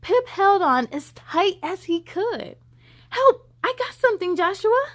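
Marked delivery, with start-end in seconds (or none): 3.1-4.8 high, loud call for help; pleading cry for help. Acted. Pip begging Joshua to help with the line, loud and high. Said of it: "Pip pleaded".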